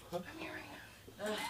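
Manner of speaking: slow whispered demand; imitated child whispering; kid's whisper. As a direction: whisper it like a small kid summoning an adult. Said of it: "they just whisper in my ear".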